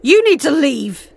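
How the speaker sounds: angry order. Acted. Leia ordering Vader's ghost to leave, angry and loud. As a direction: throw him out of the room.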